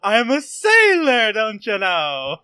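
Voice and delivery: loud, high goofy sailor puppet. Pretend. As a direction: ham up a silly sailor puppet. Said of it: "Do a puppet voice".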